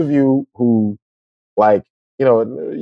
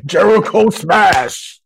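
slow, higher mock Hulk roar; hammed-up Hulk bellowing his own name; Hulk smash; 9 semitones higher, slower than usual. Justin playing the hypocrisy Hulk, slow mock roar. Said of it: "'cause Justin is like the hypocrisy Hulk".

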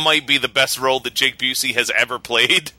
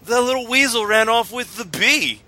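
higher, lazy stoner whine; higher stoner voice griping about the thief; 7 semitones higher than usual. Retold or imitated. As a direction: complain like a stoned college kid. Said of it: "our high guy impression".

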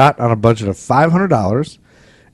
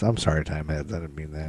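softly backing down, much quieter; quiet apology; 6 semitones lower, 11 dB quieter than usual. Real, not acted. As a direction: mumble a sheepish sorry. Apology after joke insult.

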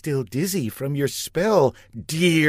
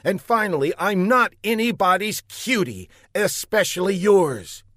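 indignant; 8 semitones higher than usual. A narrator's reading. Marcia rebuffs Buck indignantly.

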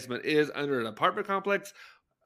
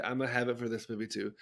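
ordinary talk

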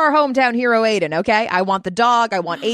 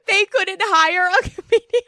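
laughing; 11 semitones higher than usual. Real, not acted. Friends crack up over bad joke.

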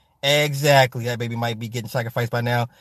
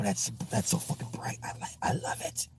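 hushed whisper at the ring light; 3 semitones higher, 15 dB quieter than usual. Real, not acted. To the viewers about the ring light, whispered. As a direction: breathe the words, no voice.

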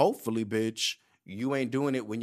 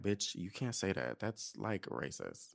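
hushed warning; 4 semitones lower, 9 dB quieter than usual. Retold or imitated. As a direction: lower and much softer, a hushed correction. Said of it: "And he was like".

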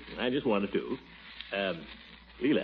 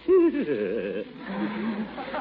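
nervous giggle; 8 semitones higher than usual. Acted. Awkward joke about dancing school.

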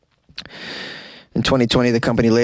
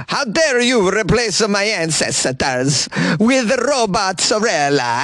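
hammed-up Italian accent, slow; mock-offended Italian nonna; 7 semitones higher than usual. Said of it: "As an Italian boy, I'm very offended".

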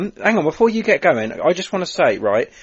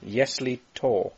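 slow careful repeat; 4 dB quieter, much slower than usual. Shown as a demonstration. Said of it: "если is if".

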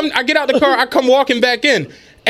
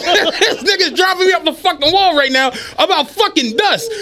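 ordinary delivery

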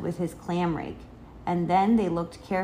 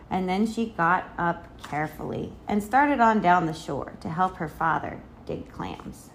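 plain reading voice

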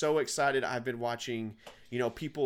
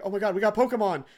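fast, high-pitched excited outburst; kids losing their minds; 9 semitones higher, 7 dB louder, faster than usual. Retold or imitated. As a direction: imitate overexcited kids, high and loud. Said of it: "they were just losing their minds".

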